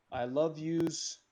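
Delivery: usual speaking voice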